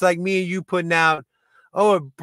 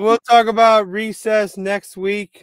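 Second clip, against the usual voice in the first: mock announcer tease; 5 semitones higher, 6 dB louder, slower than usual. Teasing announcement of next topic.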